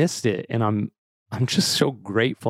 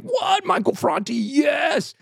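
thrilled shout; slower than usual. Retold or imitated. Daughter hears about her favorite singer's concert, thrilled shout. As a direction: shriek it high like a thrilled daughter.

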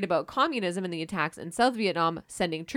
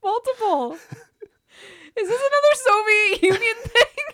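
laughing disbelief; 14 semitones higher, 8 dB louder than usual. Real, not acted. Stunned, laughing at the history.

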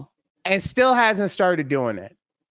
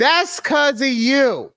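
laughing; 7 semitones higher, slower than usual. Real, not acted. Teasing, breaks into laughter.

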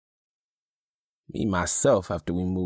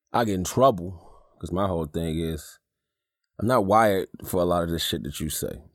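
ordinary talk

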